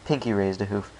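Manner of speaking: ordinary delivery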